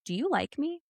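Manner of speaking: retold earnest question, rising at the end; suddenly serious. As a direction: drop the joke and ask sincerely. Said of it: "totally different tone of voice. The joking was gone".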